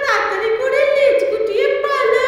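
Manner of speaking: high, slow, sing-song ghost wail; high, slow, eerie sing-song witch voice; shakchunni witch voice. Acted. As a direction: wail it eerily like a pond ghost. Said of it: "শাকচুন্নির আওয়াজ".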